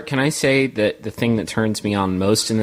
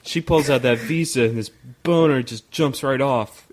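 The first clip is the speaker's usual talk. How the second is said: aroused dude; 6 semitones higher than usual. Pretend. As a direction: ham up a turned-on guy. Voicing aroused guy.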